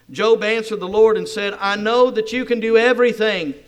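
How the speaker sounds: loud, fervent reading of the prayer; full-force preaching. Acted. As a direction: proclaim the verse to the crowd. Reads Job at full force.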